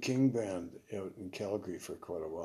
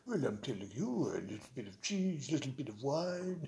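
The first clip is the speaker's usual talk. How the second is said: mock French accent; 3 semitones higher than usual. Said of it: "sing it in a French accent".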